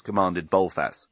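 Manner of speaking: plain reading voice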